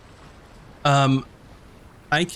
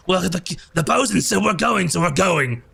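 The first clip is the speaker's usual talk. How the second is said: Slug the goblin; 5 semitones higher than usual. Acted. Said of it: "He's like".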